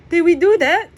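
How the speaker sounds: high loud cracking voice. Acted. Jack panicking to Press about the fire, high cracking voice. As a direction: let the panic crack through. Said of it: "My voice cracks".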